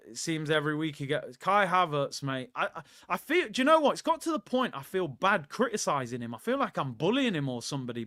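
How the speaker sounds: ordinary talk, nothing marked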